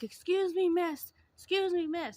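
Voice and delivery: high, loud, pleading call; pleading beggar voice. Retold or imitated. Imitating a woman begging at the gas station, high pleading call. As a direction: mimic the stranger begging for attention, high and loud. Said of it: "she was like".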